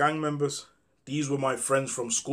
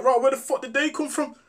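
playing dumb; 11 semitones higher than usual. Speaker pretending ignorance to his friends, in mock high surprise. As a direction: act innocent and clueless. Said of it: "I'm like".

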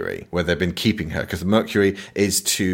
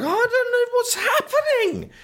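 very high, comic squealed panic; hammed-up helpless wail; mock panic. Tom watching his mate stab a soldier, mock panic.

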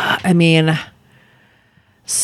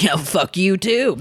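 defiant; 6 semitones higher, slower than usual. Retold or imitated. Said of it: "I would have been like".

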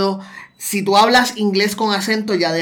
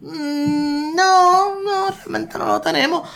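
high-pitched, drawn-out doubtful no; high, softened contradiction; skeptical denial; 9 semitones higher, much slower than usual. Acted. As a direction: draw out a reluctant hum, then disagree up high. Answers the quoted claim with doubt.

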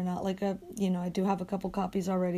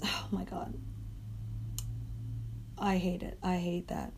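whispering; 3 semitones lower, 10 dB quieter than usual. Real, not acted. Hoarse voice frustration.

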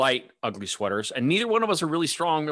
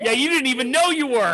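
amused teasing; 11 semitones higher, 5 dB louder than usual. Real, not acted. Teasing amid shared laughter.

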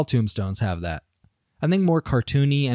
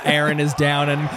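usual speaking voice

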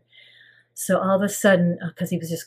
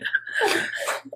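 tearful laugh; 13 semitones higher than usual. Real, not acted. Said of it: "I'm crying".

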